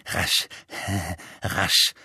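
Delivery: low, murmured whistling voice. A narrator's reading. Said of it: "eine pfeifende Stimme murmeln".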